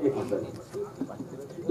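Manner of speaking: whispered quick pause request; hushed aside. Real, not acted. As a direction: whisper it off-mic.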